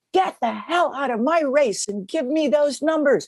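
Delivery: retold furious scream. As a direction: replay the official's rage, half-acted. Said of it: "He screamed at me and said".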